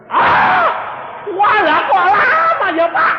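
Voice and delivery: very high, loud drawn-out shriek; mock dismayed wail. Questioner hears perfection comes at death, loud high wail. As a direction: wail loudly at the long wait.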